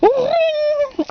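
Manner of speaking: high ringing imitation of a phone. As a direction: ring out like a telephone bell.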